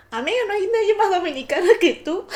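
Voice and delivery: mock outrage cracking into laughter; amused teasing shout; laughing disbelief. Friends laughing at her.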